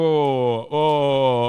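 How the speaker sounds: ordinary delivery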